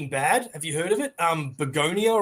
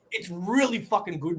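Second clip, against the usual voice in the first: ordinary delivery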